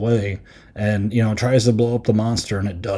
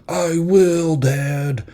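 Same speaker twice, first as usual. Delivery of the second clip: mock earnest promise; 6 semitones higher, much slower than usual. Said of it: "the monster's like".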